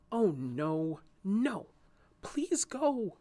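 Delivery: anxious, insistent protest; insistent pleading. A narrator's reading. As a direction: beg the bees to leave. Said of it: "she insisted to them".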